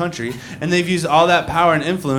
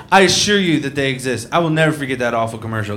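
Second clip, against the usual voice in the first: ordinary talk, nothing marked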